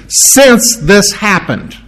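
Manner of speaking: since drawn out slowly and leaned on; slow, weighty since; stress on since. Shown as a demonstration. Preacher explaining the verse, slow stress on since. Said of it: "you can put the word "since" in there".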